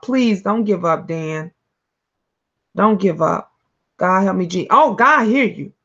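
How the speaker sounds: ordinary delivery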